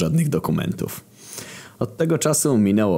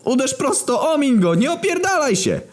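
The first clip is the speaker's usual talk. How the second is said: roaring from the stands. Acted. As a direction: roar it across the whole stadium. Coach yelling from stands.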